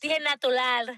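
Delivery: natural said as natulal, R turned to L; natural with both sounds as L, natulal; Puerto Rican accent. Shown as a demonstration. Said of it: "con dos L".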